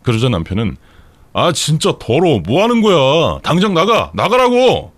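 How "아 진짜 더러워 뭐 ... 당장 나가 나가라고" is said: disgust building to a high yell; slow irritation climbing into acted shouting; angry shouting. A narrator's reading. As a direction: order them out with rising fury. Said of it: "하면서 소리를 쳤고".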